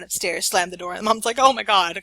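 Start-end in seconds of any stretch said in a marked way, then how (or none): 1.4-2.0 exasperated mom. Retold or imitated. Exasperated mom after slammed door.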